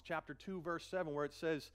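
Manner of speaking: ordinary talk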